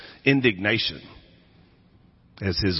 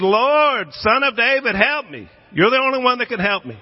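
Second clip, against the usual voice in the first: much higher, louder frantic plea, speeding up at the end; desperate pleading cry; 11 semitones higher, 4 dB louder than usual. Retold or imitated. Canaanite mother cries out to Jesus for her daughter, frantic high pleading. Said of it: "she yelled and she screamed".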